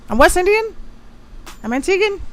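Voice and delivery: high proud "I'm West Indian"; proud exclamation. Real, not acted. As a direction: declare your roots proudly, pitch way up.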